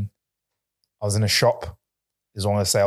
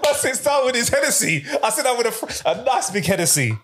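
booming tipsy uncle; 8 semitones higher than usual. Pretend. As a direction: play the merry boozy uncle while laughing. Laughing, imagining tipsy Christmas host.

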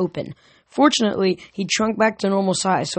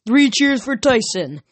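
leading a cheer; 6 semitones higher, 4 dB louder than usual. Acted. Grover leads a cheer.

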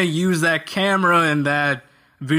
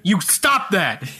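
higher, slow telling-off; mock stern scolding; 4 semitones higher, slower than usual. Yoshi slaps the wizard mid-speech, mock stern. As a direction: scold like someone correcting a naughty pet.

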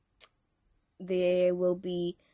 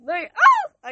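giggling; 13 dB louder than usual. Real, not acted. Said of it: "He got me giggling".

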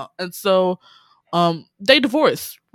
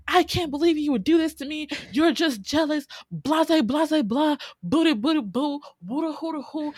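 mocking the friend; 7 semitones higher than usual. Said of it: "She's like".

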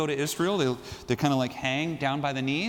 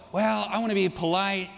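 a hint of a timid, dithering woman, raised; hesitant hemming; 6 semitones higher than usual. Preacher mimics the woman's hemming and hawing, lightly raised. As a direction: hem and haw politely, a bit higher. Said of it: "She doesn't go".

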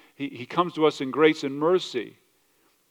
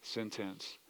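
hushed, much softer awe; awed hush; 5 semitones lower, 15 dB quieter, slower than usual. Retold or imitated. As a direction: murmur it, overwhelmed. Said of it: "people would have been like".

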